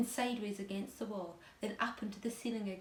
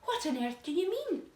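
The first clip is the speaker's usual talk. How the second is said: alarmed question; 8 semitones higher, 5 dB louder than usual. A narrator's reading. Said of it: "they cried".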